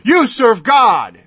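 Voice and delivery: slow and loud, each word hammered; slow emphatic declaration. Real, not acted. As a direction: plant every word firmly. Preacher driving home point.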